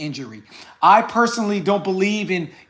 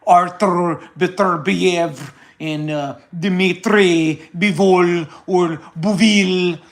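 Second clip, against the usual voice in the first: lightly put-on Russian accent, slowed; mock Russian accent; slower than usual. Mocking clown channels, slow mild fake Russian accent. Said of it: "fake an accent and say".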